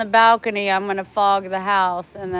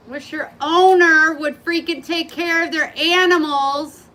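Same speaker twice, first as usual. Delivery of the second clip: annoyed; 6 semitones higher, 5 dB louder than usual. Real, not acted. Scolding absent owner.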